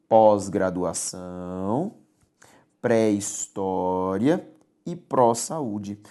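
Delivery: pós, pré and pró spoken slowly, each prefix open and stressed; slow careful pronunciation. Shown as a demonstration. Teacher slowly demonstrating stressed prefixes to the class. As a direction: spell out each prefix sound, slow and low. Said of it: "comentário especial sobre três deles".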